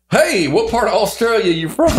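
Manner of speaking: high-pitched, eager mock question; imitating ignorant Americans. Clueless American greeting a South African, imitated eager curiosity. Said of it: "people are like".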